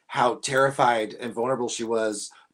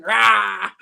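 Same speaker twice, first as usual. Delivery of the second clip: demon roar; 6 semitones higher, 8 dB louder than usual. Retold or imitated. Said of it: "She's like".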